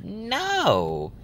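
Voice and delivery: high, stretched-out "no"; exclaimed refusal. Real, not acted. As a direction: let the no soar in protest. Refusing the surgery price.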